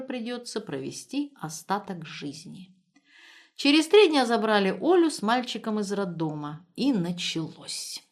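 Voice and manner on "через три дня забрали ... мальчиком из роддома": ordinary delivery, nothing marked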